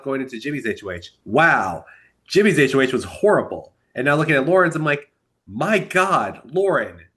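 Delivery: ordinary delivery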